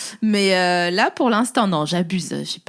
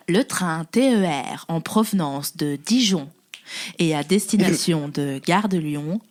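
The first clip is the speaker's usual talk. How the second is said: slow, low station announcement voice; train station announcer; 6 semitones lower, 3 dB quieter, slower than usual. Pretend. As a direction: announce it like a railway PA. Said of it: "je fais trop bien la voix de".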